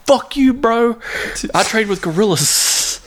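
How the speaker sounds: high, put-on bro voice; mock Bigfoot voice. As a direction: sneer it like an offended bro. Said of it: "And it's like".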